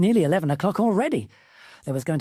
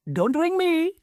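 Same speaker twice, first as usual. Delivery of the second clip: cheeky phone brush-off; 12 semitones higher, slower than usual. Acted. Cutting off a phone call.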